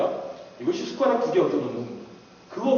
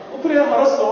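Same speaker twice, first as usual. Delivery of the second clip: higher and louder, quick assent; eager loud yes; 9 semitones higher, 9 dB louder than usual. Retold or imitated. Younger brother eagerly agreeing to his older brother's request. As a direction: shout out an eager okay.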